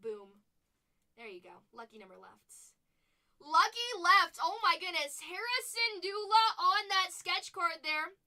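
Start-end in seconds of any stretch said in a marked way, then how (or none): 3.4-8.2 excited shout. Real, not acted. Host reveals a sketch card to viewers, excited shout. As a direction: belt out the big reveal.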